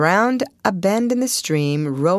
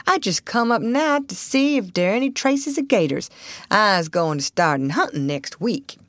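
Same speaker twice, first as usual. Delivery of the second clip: put-on old Southern boatman, chatty; 5 semitones higher than usual. Voices old boatman in dialect.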